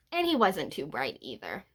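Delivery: ordinary delivery, nothing marked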